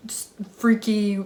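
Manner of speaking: ordinary delivery, nothing marked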